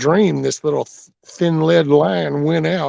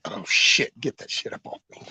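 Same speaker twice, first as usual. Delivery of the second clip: whispered hen voice; 3 semitones lower, 6 dB quieter than usual. Retold or imitated. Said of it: "she's got to like".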